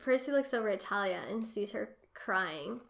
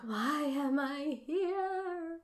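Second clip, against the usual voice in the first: high, drawn-out mock lament; mock wail; 5 semitones higher, much slower than usual. Host voices Talia's thought, a drawn-out mock wail. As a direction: draw it out high like a helpless wail. Voicing a bewildered character's thought.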